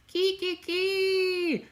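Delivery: very high, squealed witch cackle; high "kee-kee-kee" cackle; mock witch's laugh. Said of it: "Dahlia laughing like a witch".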